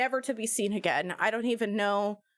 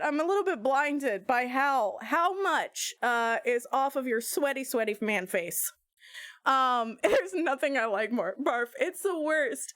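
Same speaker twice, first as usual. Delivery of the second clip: ordinary talk, nothing marked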